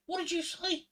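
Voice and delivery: high-pitched, loud mock outrage; shocked barmaid. Barmaid challenging a cheeky customer in a joke, mock shock. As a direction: play an affronted barmaid, pitch shooting up.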